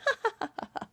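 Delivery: high, soft mock laughter; light imitation of a taunting laugh. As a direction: laugh at him in a spelled-out ha ha. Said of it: "she laughs at him".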